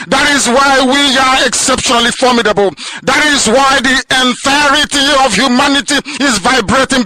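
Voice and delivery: high, loud fiery sermon cadence; preaching at full force. Acted. Leader rallying listeners on radio, preaching at full force. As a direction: thunder it out like a pulpit preacher.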